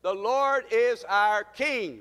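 slow, ringing preacher's declaration; congregational declaration. Real, not acted. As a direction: lead the church in saying it, drawn out. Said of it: "Let's say it together".

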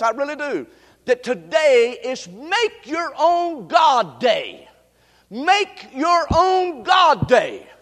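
slow, emphatic proclamation building to a shout; forceful preaching. Acted. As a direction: proclaim it to the congregation, stretching the key words. Preaches the sermon's main point.

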